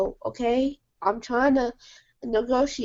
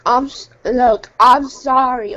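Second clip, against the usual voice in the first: angry apology; 7 dB louder than usual. Real, not acted. Forced apology to rival on podcast.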